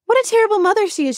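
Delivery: high, fast mocking exclamation; mock outrage. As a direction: sarcastically parrot the judgmental listener. Mocking judgmental listeners.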